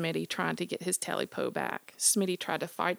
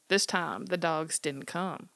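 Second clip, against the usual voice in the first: usual speaking voice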